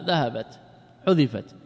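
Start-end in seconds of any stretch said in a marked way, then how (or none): none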